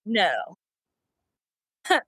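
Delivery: ordinary talk, nothing marked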